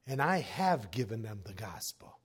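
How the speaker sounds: hushed, whispered aside. Retold or imitated. Telling the pastor off in a whisper. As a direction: drop to a pointed whisper.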